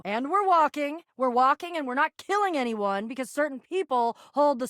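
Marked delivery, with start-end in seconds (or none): none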